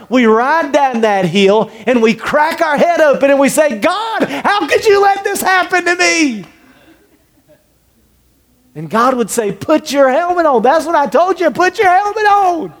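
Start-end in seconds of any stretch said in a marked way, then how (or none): 3.8-6.5 high, wounded whine; complaining to God. Retold or imitated. Injured person complaining to God. 9.5-12.7 high, pointed reminder; told-you-so God. Retold or imitated. God answering the complainer.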